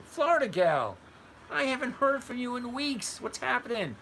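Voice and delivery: loud, high-pitched hail; excited greeting. Real, not acted. Host hailing Florida Gal after weeks away, excited shout. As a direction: call out high to a long-lost regular. Hails a long-absent viewer.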